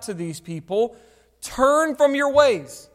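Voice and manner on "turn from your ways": loud, slow, drawn-out call, pitched up. Acted. Preacher voicing God calling to straying people, loud calling out. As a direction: call out a warning to people far off. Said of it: "continuously calling out".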